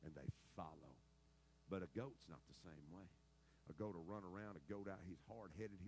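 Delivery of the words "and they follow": slow, soft and reverent; quoting Jesus. Retold or imitated. Preacher quoting Jesus to the church, slow and tender. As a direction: let the words land gently. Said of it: "Jesus said".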